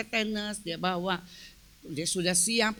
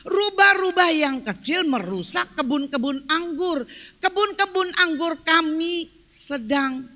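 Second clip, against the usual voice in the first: raised, forceful sermon delivery; emphatic preaching; 5 semitones higher, 5 dB louder than usual. Real, not acted. Preacher teaching from scripture about marriage, emphatic preaching. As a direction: drive the lesson home to a congregation.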